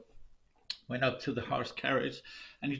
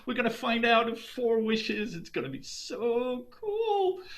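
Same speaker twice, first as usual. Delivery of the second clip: giddy, higher, eager man; bubbling over about the wishes; excited; 8 semitones higher than usual. Acted. Farmer thrilled at his four wishes, excited and eager.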